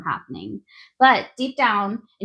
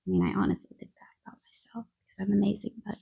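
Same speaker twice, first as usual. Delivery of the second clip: whispered aside; 7 dB quieter, much faster than usual. Real, not acted. Joking aside about herself.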